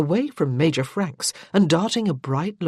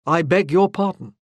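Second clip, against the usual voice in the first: louder, stiff formal apology; 5 dB louder than usual. A narrator's reading. Lord Gordon to Morgan after her rebuke, stiffly. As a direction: clip it, offended and stiff. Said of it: "he said again stiffly".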